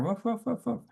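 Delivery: high yapping barks; mock dog barking; imitated dog barks. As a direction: bark like the guard dogs. Dogs guarding the road.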